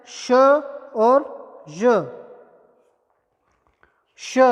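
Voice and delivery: slow demo of श and य; drawn-out sound demo. Shown as a demonstration. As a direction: stretch श and य very slowly, held long. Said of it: "इसके बाद है".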